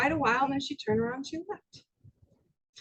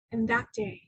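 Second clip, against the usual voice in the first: usual speaking voice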